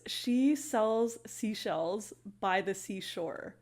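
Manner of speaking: very slow, careful delivery of each word; slow tongue twister. Shown as a demonstration. Teacher reading a tongue twister to viewers, very slowly. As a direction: take it slow so every sound is clean. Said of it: "Easy enough if you say it slowly".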